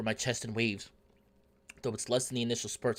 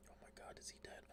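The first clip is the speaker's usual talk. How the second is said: barely audible whispered shock; hushed disbelief; 25 dB quieter, faster than usual. Real, not acted. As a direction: whisper it to yourself, stunned. Reader reacts to death scene.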